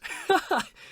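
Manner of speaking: short, high chuckle; amused laugh. Real, not acted. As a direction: break into a quick high-pitched laugh. Amused at own remark.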